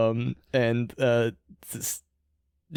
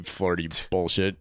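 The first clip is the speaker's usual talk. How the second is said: ordinary talk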